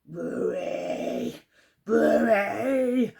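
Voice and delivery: slow, drawn-out growling threat; growling tiger. Acted. Tiger growling at old Buri, slow and drawn out. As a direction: growl each word out slowly. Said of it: "he growled".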